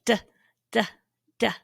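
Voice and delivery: D said as short voiced tongue taps; d, d, d sounded out one by one; isolated d sound. Shown as a demonstration. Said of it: "Look at my mouth while I do that".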